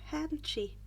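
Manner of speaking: usual speaking voice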